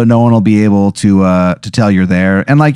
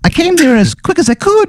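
very high squeaky Irish brogue; Irish accent. Acted. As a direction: chirp it like a tiny Irish sprite. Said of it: "I can't do the accent".

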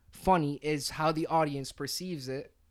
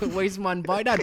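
put-on Jamaican accent; 3 semitones higher, 5 dB louder, slower than usual. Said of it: "Our best impression of Jamaican or patois".

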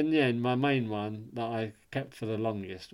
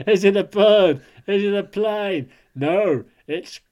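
big booming announcer voice; superhero announcer; 7 semitones higher, 12 dB louder than usual. Pretend. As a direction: announce it like a movie trailer.

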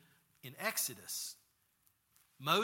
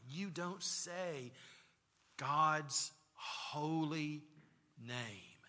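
very slow, deliberate instruction; slow solemn reminder; much slower than usual. Retold or imitated. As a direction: space out the words like a rule being taught. Voicing a reminder taught to children.

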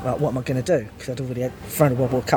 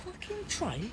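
high and very hushed; stunned hushed outrage; 17 dB quieter than usual. Retold or imitated. Woman thinking about queue jumper, hushed high outrage. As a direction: breathe it in scandalised disbelief.

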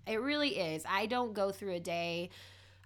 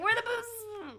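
mock high squeak; 13 semitones higher, slower than usual. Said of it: "I'm gonna be like".

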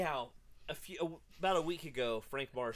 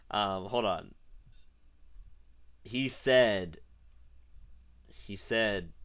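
normal talking voice

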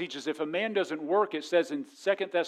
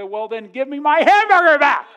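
much louder, higher comic demand; indignant shout; 9 semitones higher, 13 dB louder than usual. Retold or imitated. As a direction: yell it after him in mock outrage. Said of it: "And I said".

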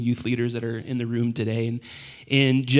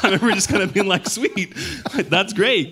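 higher, fast and laughing; 7 semitones higher than usual. Retold or imitated. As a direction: laugh through it quickly. Retold amused reaction.

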